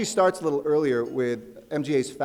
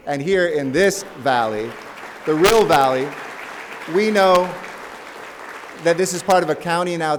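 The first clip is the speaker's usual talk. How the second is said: rallying cry; 4 semitones higher, 7 dB louder than usual. Real, not acted. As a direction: build it up and belt it to the room. Rallying crowd about the Valley.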